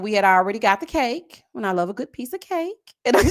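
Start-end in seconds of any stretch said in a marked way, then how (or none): none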